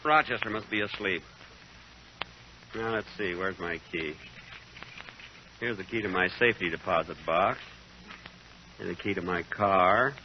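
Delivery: low, soft mumbling to himself; muttering to himself. Acted. Man alone hunting for his key, muttering. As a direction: talk under your breath while sorting keys.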